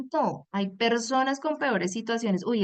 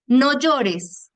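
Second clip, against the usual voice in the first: stern order; 4 semitones higher, 8 dB louder than usual. Retold or imitated. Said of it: "quoting a typical reproach".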